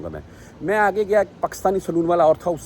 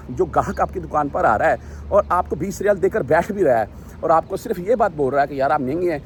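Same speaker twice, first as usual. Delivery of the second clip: ordinary talk, nothing marked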